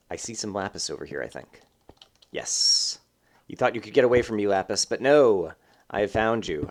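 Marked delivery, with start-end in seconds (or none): 2.3-3.0 a breathless unvoiced "Yes."; whispered yes. Real, not acted. Spotting lapis. 3.5-6.7 hammed-up gloating villain, louder; mock villain. Taunting the lapis ore, a hammed-up gloating villain.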